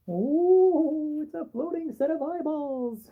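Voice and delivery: high delighted surprise; surprised. Real, not acted. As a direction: gasp at the glowing eyes. Spooky eyeballs in the dark.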